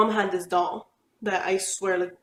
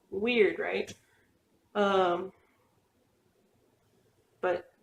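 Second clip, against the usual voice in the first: ordinary talk, nothing marked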